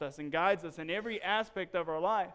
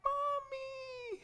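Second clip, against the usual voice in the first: put-on high, soft, drawn-out child's cry; small, high, faraway little-boy voice; tiny distant voice; 6 dB quieter than usual. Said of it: "we hear this tiny voice".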